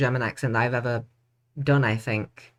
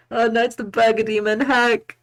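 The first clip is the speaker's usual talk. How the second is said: mock alarm; 8 semitones higher, 3 dB louder than usual. Mock alarm at imagined demon.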